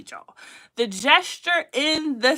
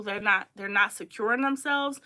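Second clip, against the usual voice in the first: ordinary delivery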